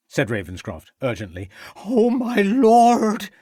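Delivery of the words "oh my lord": high, loud, drawn-out cry of dismay; anguished exclamation. A narrator's reading. Cook hearing the boy may die, a high loud slow wail. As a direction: wail it as she bursts into tears. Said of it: "exclaimed the cook, bursting into tears".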